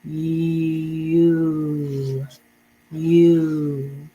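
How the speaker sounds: you drawn out, almost sung; modeled word. Shown as a demonstration. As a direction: hold the word you long and clear. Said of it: "try to pronounce it on your own".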